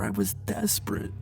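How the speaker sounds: desperate whisper fading out. Acted. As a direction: barely voice it. Desperate confession to lover.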